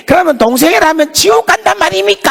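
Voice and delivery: 1 defiant challenge. Retold or imitated. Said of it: "막 달라드는 거예요".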